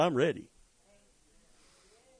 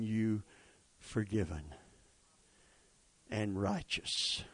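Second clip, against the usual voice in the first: low, soft and slowed; quiet reverent close; hushed; 5 semitones lower, 6 dB quieter than usual. Acted. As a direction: let the words settle softly.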